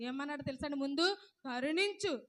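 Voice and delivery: normal talking voice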